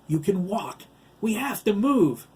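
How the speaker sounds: high, loud urging; urgent order. Acted. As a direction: push a friend to flee at once. Urgent order during an attack.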